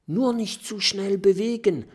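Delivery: ordinary delivery, nothing marked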